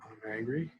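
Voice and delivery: softly, inconspicuously murmured mantra; nonchalant. Shown as a demonstration. Teacher showing how to do it unnoticed in class, nonchalant. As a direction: say it offhand, under the radar. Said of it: "inconspicuous type thing or a nonchalant".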